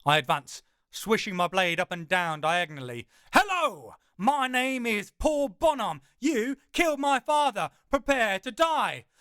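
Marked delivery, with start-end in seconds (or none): 3.3-9.1 higher, louder mocking Montoya line; loud Inigo parody. Narrator taunts the hound, high loud Montoya parody. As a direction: gleefully swap in a new name. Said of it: "I can switch it up".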